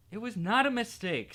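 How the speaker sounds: said angrily. A narrator's reading. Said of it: "said Harry angrily".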